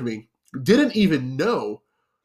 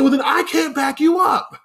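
manager's shout; 11 semitones higher than usual. Retold or imitated. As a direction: slam the door on the argument with a shout. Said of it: "He said".

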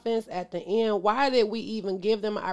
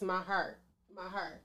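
softer and softer, my heart fading out; pained fading; 4 semitones lower, 12 dB quieter than usual. Real, not acted. Fan too hurt to finish, fading to nothing. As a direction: let it fade away in pain. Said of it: "I just need a minute 'cause my heart".

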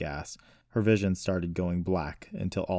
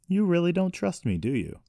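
higher, louder hurt anger; growing anger; 12 semitones higher, 5 dB louder than usual. A narrator's reading. Brother to distrusting sister, growing angrier. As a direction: accuse them, temper rising. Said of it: "sounding angrier".

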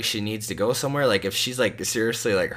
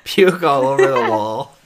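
higher and louder, laughing through the words; 13 semitones higher, 12 dB louder, much slower than usual. Real, not acted. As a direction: crack up while joking. Jokes about vomiting at birth.

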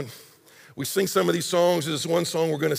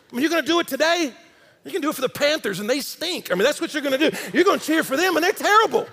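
playful high teasing; 8 semitones higher, faster than usual. Real, not acted. Joking about Panthers fans.

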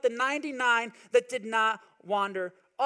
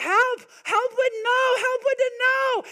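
put-on high, louder toddler plea, slow; a toddler with cold, snowy hands wailing for help; toddler crying for help; 13 semitones higher, 6 dB louder than usual. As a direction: imitate a two-year-old begging for help. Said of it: "he'd be like".

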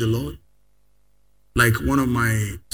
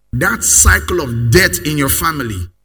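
loud, higher, driving proclamation; full-force preaching; 4 semitones higher, 8 dB louder than usual. Acted. Pastor declaring an end to death, loud preaching. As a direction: build it up and hurl it at the church.

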